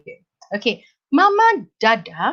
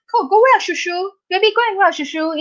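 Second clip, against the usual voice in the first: put-on baby talk; 10 semitones higher than usual. Shown as a demonstration. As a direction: coo "shushu" to a toddler at the door. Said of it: "that is baby talk".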